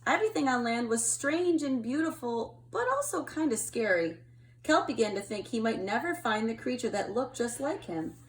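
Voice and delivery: put-on gentle storytelling read to kids; picture book reading. A narrator's reading. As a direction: read it like to a child at bedtime.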